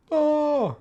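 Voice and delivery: very high, loud drawn-out "oh"; startled yell. Real, not acted. Animator copying frames hits a snag, startled yell. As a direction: cry out as it goes wrong.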